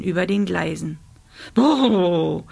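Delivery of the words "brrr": lip-trilled shiver noise; shuddering brr. A narrator's reading. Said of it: "Rosa schüttelte sich".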